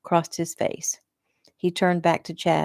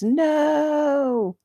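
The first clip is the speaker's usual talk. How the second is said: a high, far-off scream of no; 11 semitones higher than usual. Acted. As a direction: scream it as the nightmare breaks. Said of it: "screaming at the top of her lungs".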